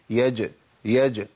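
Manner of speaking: يج said slowly with a light bounce on the jeem; qalqala on jeem. Shown as a demonstration. Teacher demonstrating the qalqala, slow and gentle. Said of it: "لابد إن أنا أقول إيه".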